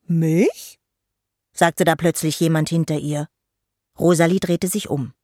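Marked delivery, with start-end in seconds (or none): 0.1-0.8 lightly voiced troll boy, questioning; a hint of a cheeky boy; cheeky interruption. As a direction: butt in with a teasing rising question. Troll boy butts into the song.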